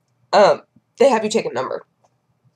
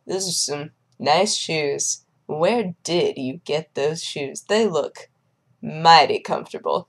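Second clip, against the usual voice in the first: slow, lower, softer drawl; swaggering shoe admirer; 4 semitones lower, 4 dB quieter, slower than usual. Retold or imitated. Man sidles up to admire someone's shoes, slow drawl. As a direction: imitate his unhurried, low-key swagger. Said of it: "That is exactly how he sounded".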